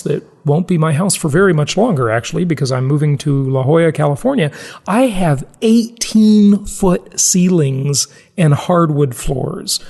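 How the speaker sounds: ordinary talk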